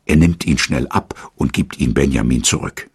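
plain reading voice

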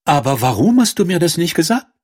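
high-pitched princess voice; questioning princess. A narrator's reading. Princess asks the prince why he kept silent, high-pitched question. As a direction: ask up high, puzzled.

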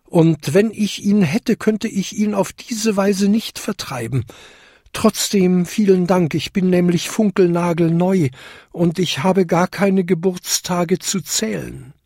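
high-pitched, polite newcomer bell; tinkling little bell. A narrator's reading. Smallest bell explains herself to the biggest, high tinkling. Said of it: "klingelte die kleinste Glocke".

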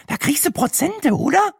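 very high-pitched, hopeful question; squeaky inquisitive woman. Acted. Brenda asks Sina about staff discounts, squeaky and eager.

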